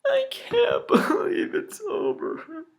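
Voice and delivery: slow, high voice cracking into sobs; choked up with real tears; crying. Real, not acted. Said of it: "before I start tearing up".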